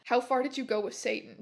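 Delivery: ordinary delivery, nothing marked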